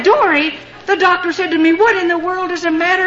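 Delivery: retold startled outburst; exclaimed demand. Startled doctor demands an explanation.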